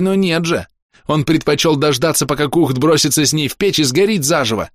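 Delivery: high, heated tirade; growling accusation. A narrator's reading. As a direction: hurl bitter accusations without letting up. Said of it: "прорычала Хоули".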